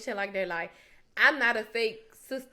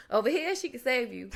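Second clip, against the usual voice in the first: ordinary delivery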